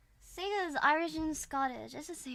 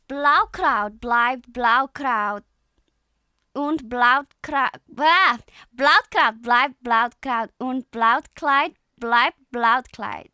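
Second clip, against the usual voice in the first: loud, slow German tongue twister; 12 dB louder, slower than usual. Shown as a demonstration. Attempting a German tongue twister for listeners, loud and deliberate German. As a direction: pound out each German word carefully. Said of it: "I can do a German tongue twister".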